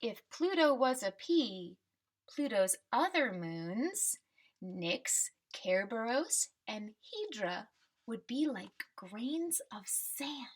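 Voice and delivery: slow, bright storybook read with a lift on Pluto; reading to children. Acted. Teacher reading the class a picture book, storybook voice.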